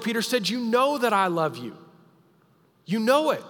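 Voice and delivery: high, loud pleading protest; desperate insistence. Acted. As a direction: insist he loves him, voice straining high. Peter answers again, pressed.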